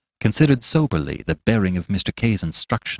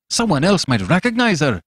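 objecting in a higher pitch; 6 semitones higher than usual. A narrator's reading. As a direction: raise a worried objection, pitched up. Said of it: "objected Fettes".

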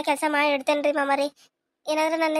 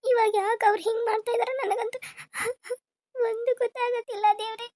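higher, weepy break on the words; crying lament; 5 semitones higher, 3 dB quieter than usual. Acted. Daughter-in-law, alone, cries to God over her father-in-law. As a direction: let the tears come through.

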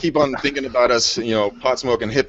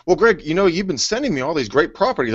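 cheerful client; 6 semitones higher than usual. Retold or imitated.

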